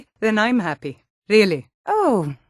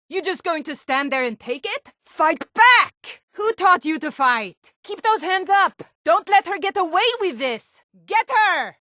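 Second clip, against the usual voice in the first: rile up the brawlers from the sidelines; rowdy fight-crowd heckling; egging on a fight; 10 semitones higher than usual. Acted.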